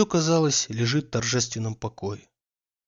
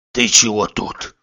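louder, lower hoarse challenge, slow; 4 semitones lower, 5 dB louder, slower than usual. Acted. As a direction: growl the question hoarsely. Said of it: "спросил он хрипло".

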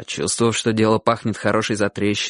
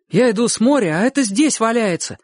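put-on high, excited boyish voice; boy's voice; 10 semitones higher than usual. A narrator's reading. Boy showing the others what he found, high and excited. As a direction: tell it high and eager, like a boy. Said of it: "говорил мальчик, державший в руке".

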